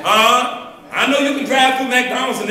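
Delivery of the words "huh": usual speaking voice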